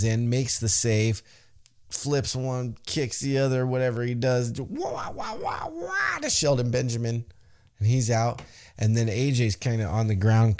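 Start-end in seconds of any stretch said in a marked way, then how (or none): 4.6-6.2 high-pitched "wah" flurry; cartoonish high strike noises; imitated fighting noises. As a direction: rattle off high mock kung-fu wahs.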